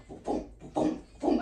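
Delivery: breathy, voiceless booms; imitated landing bumps. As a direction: puff out the plane's thuds. Imitates plane bumps while landing.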